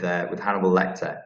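usual speaking voice

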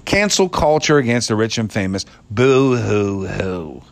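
slow, whiny boo-hoo-hoo; mock sob the boo-hoo-hoo; fake crying. Mock crying for famous people.

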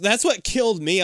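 ordinary talk, nothing marked

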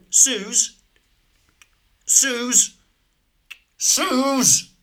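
high-pitched call for someone elsewhere; summoning someone from another room. Acted. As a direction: call the name up high, drawn out.

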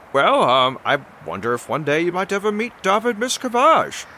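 high-voiced, halting adventurer; Odysseus, politely curious. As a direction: play a high, tentative, courteous hero. Plays Odysseus in the game.

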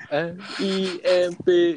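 slow letter by letter, slightly high; spelled out slowly. Shown as a demonstration. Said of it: "muni unine ra guyon".

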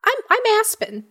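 small, high, stumbling voice; high gnome voice, hesitant. Acted. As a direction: fumble for words like a young gnome. Gnome gives its name.

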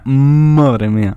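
loud, very slow madre mía; drawn-out exasperation. Real, not acted. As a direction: stretch it out in dismay. Dreading the next trailers. (madre mía muy alargado y exasperado)

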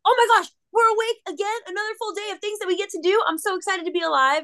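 very high, fast, bubbly puppy voice; squeaky, thrilled little dog; excited dog voice. Pretend. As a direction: voice the dog bursting with joy at waking. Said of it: "He's like".

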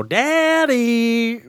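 put-on high, slow pleading little-kid voice; whiny kid voice. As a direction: beg like a helpless child. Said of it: "call my dad and go".

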